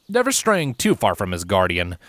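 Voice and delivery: ordinary delivery, nothing marked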